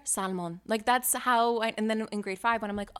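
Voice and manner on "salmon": "salmon" with the L sounded, SAL-mon; Polish-household pronunciation. Retold or imitated. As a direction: say "salmon" as SAL-MON, full O in the second syllable. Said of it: "every single Polish person I know".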